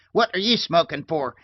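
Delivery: put-on high, loud, scandalised old woman; loud cranky tirade; angry scolding. A narrator's reading. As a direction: tear into the maid. Old lady scolding the maid.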